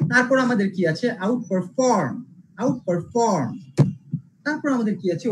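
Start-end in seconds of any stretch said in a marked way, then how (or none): 1.3-3.5 আউটপারফর্ম with form punched and falling; stress on the verb part. Shown as a demonstration. Teacher repeating an example for students, আউটপারফর্ম stressed on form. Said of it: "second সিলেবলে pressure দিব".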